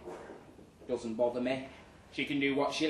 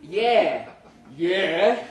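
mock taunt; 3 semitones higher, 10 dB louder than usual. Imaginary fight in mirror.

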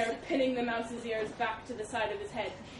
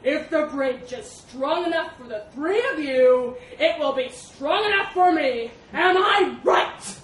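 slow, booming elephant bellow, building higher; roaring; 5 semitones higher, 13 dB louder than usual. A narrator's reading. Elephant demanding the mouse agree, roaring. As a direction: bellow it down at a tiny victim. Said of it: "roared the elephant".

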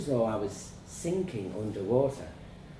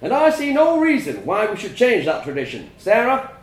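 proud, louder father holding forth at dinner; a father preaching tradition to his family; stern farmer father lecturing; 9 semitones higher, 10 dB louder than usual. A narrator's reading. Father lecturing his son over Sunday dinner, proud and louder.

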